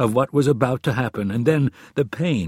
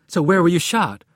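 high, louder puzzled question; concerned, puzzled; 8 semitones higher, 7 dB louder than usual. A narrator's reading. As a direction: ask with worried confusion. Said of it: "Concerned and puzzled, Anna asked".

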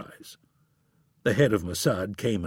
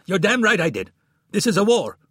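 yelling; 10 semitones higher, 7 dB louder than usual. A narrator's reading. Friedman screaming in quarrel.

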